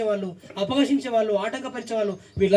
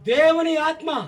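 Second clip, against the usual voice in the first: very slow, high-pitched verse; raised, drawn-out preaching voice; scripture proclamation; 9 semitones higher, 5 dB louder, much slower than usual. Real, not acted. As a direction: stretch the words out for the congregation. Proclaims verse after reader.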